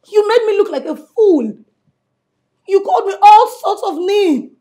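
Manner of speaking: furious outburst at the husband who wronged her; angry. Acted. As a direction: throw the insults back at him. Wife confronts cheating husband.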